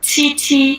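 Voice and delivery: छी with a strong puff of air; aspirated chhi. Shown as a demonstration. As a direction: demo छी clearly, twice. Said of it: "pronunciation सुनाइए इसकी". (प्रोनाउंसिएशन दिखाते हुए छी)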